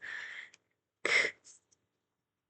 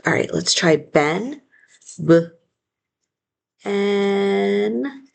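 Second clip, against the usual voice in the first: ordinary talk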